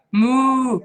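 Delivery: loud mooing like a cow; playful cow moo. Shown as a demonstration. Teacher imitating cow sound.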